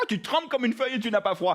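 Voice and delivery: ordinary talk, nothing marked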